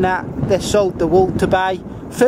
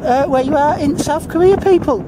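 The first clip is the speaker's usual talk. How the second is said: cheery sign-off; 7 semitones higher than usual. Real, not acted.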